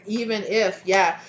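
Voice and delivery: usual speaking voice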